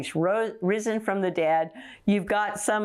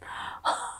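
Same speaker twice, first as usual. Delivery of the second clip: breathy laugh; 6 dB quieter than usual. Real, not acted. Delighted by the scripture.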